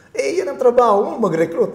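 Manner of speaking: the boss reminding him of his hiring duty, mildly stern; lightly voiced boss. Boss quoted, loud high.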